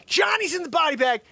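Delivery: hammed-up victory shout; mock triumphant shout. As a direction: crow it at the crowd. Triumphant retold taunt.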